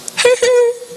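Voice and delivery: brief high-pitched chuckle; short laugh. Real, not acted. Laughs after retold warning.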